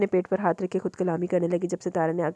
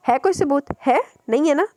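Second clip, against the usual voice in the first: higher, louder taunting demand; mocking taunt; 7 semitones higher, 6 dB louder than usual. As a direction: goad her for proof. Madam taunting her. (ऊँची, तंज़ भरी ललकार)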